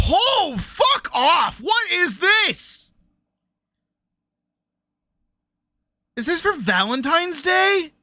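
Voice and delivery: high, loud, slow outburst; high scandalised shout; outraged disbelief. Real, not acted. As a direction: drag it out, appalled. Outraged at the dessert.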